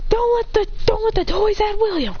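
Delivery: high-pitched battle warning; rally the team urgently; urgent rallying cry. Acted. Characters voiced rallying in battle.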